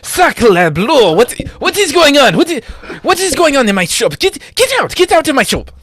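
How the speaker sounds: high, loud, outraged French shopkeeper; french chef. Acted. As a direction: shriek at the thieves in a French accent. Shopkeeper reacts to thieving monkeys.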